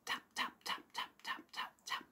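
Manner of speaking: soft unvoiced tap tap tap like a tiny hammer; tapping sound. Shown as a demonstration. Narrator imitates leprechaun's hammer, soft tapping. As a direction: tap it out softly, a cobbler at work. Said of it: "The sound of the leprechaun is".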